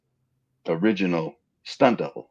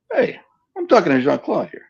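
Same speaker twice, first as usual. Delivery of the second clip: mock indignant; 9 semitones higher, 5 dB louder than usual. Mock rebuff of PA girl.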